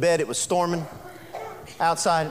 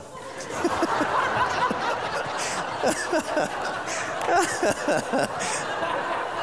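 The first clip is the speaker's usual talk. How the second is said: high breathy laughing fit; preacher laughing hard at his story; helpless laughter; 13 semitones higher, 3 dB quieter than usual. Real, not acted. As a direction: lose it laughing at the punchline.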